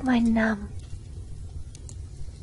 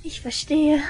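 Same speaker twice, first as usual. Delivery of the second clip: gleeful mock pity; 7 semitones higher than usual. Girl gloats to listener that rival died, gleeful. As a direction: savor the death with mock regret.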